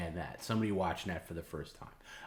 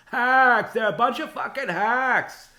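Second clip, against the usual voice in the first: very high, much louder contemptuous rant; Kevin Brennan impression; 11 dB louder, slower than usual. Imitating the host trashing rivals to Bob, loud high tirade. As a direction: spit out the insults like an enraged radio host. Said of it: "that's my Kevin Brennan impression".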